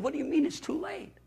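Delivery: the words catch in his throat as the news hits; a father who cannot believe it; shocked disbelief. Retold or imitated. Told his son will die.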